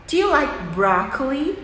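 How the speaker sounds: "Do you like broccoli" with Do swallowed into a quick d; reduced auxiliary. Shown as a demonstration. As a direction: run "Do you" together, broccoli clear and rising. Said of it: "A gente fala".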